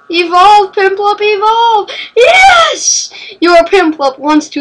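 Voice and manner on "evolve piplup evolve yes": urgent high cries building to a shrieked "Yes!"; will it on, then explode in triumph; excited cheering shout. Real, not acted. Urging on, then cheering evolution.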